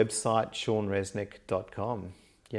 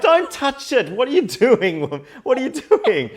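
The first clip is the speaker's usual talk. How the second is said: high, loud panicked protest; mock alarm; 10 dB louder than usual. Son stopping Mum touching the test, loud mock alarm. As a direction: cry out to stop her touching it.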